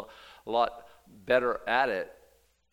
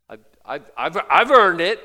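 loud, higher, indignant boast; mock boast; 6 semitones higher, 16 dB louder than usual. Giver insisting he earned it, loud mock boast. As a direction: puff up and claim it proudly. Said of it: "they're talking about".

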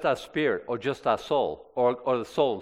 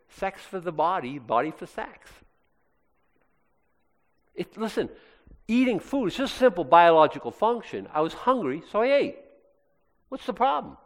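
higher, dismissive excuse-maker voice; mock Corinthian; 5 semitones higher than usual. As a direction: shrug it off like a smug Corinthian. Voicing a Corinthian excusing sin.

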